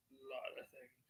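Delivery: hushed whisper, no voice. Shown as a demonstration. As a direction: breathe the words as a secret. Repeating phrase, then whispering it.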